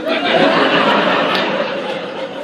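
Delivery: very high, loud shriek of laughter. Real, not acted. [name] laughing loudly while telling the car part of his date story. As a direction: howl with laughter.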